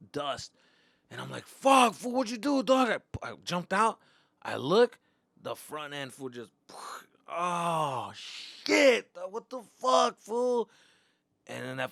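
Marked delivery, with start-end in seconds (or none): 1.5-2.9 high, loud cry after the crash; shocked yell. Retold or imitated. To the friend who crashed the car, shocked yell. 7.3-10.7 high, loud disbelief; shocked outburst. Retold or imitated. Seeing the smashed front end, loud shocked outburst.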